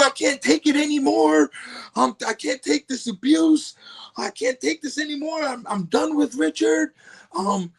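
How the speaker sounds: high, whiny mock wail of a wife, with a teary catch; mocking wife's rant. Mocking his wife ranting to producers on the phone, high whiny wail. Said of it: "ranting and raving".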